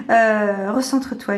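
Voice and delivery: ordinary delivery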